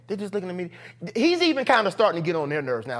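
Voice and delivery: ordinary delivery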